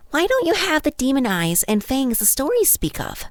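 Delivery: put-on high-pitched wondering child voice; curious child. A narrator's reading. Child questions a foreign guest at the feast, curious child. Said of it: "one of them asked".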